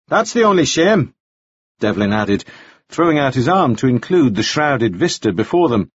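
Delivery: high, polished English gentleman voice; pleasant, chatty, a bit formal; genteel gentleman. A narrator's reading. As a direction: Devlin making polite conversation about the weather. Devlin's voice.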